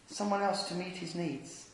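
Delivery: ordinary delivery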